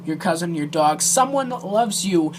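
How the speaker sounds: ordinary talk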